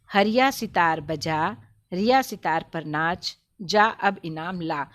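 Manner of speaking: put-on slow, deliberate reading for young learners; measured schoolroom reading with clear gaps between phrases; primer read-aloud. Shown as a demonstration. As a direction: read each short phrase word by word, stretched out. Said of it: "इसे हम लोग एक बार पढ़ लेते हैं".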